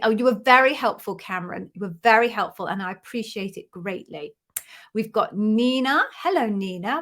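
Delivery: usual speaking voice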